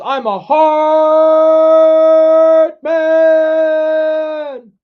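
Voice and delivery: high, loud yell with hugely stretched vowels; drawn-out mock-heroic holler; hammed-up bellow. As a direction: belt it out, holding each word very long. Hammed-up reading of chat message.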